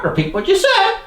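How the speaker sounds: ordinary talk, nothing marked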